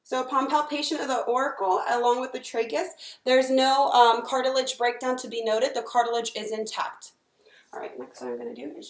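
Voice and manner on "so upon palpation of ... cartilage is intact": clinical dictation. Acted. Dictating exam findings aloud.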